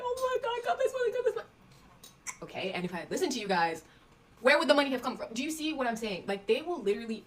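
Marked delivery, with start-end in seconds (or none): 0.0-1.5 high, gushing praise far above her own voice; hammed-up delighted parent, much higher; mock overjoyed parents. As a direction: squeal it like parents thanking God for the cash. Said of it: "Now they're sitting there like".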